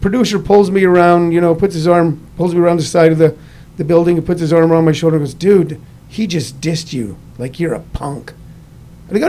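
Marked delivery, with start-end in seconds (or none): 5.4-8.3 softer, slow and conspiratorial; confidential aside. Retold or imitated. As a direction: lean in and egg him on quietly. Producer pulls him aside, arm around.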